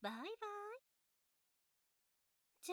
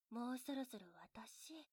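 whispering; 3 semitones lower, 7 dB quieter than usual. Acted. Teasing countdown, close to the mic.

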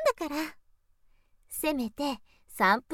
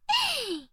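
short high giggle that falls; a little amused giggle; teasing giggle. Acted. Big sister giggling at her little brother's reaction, short high laugh.

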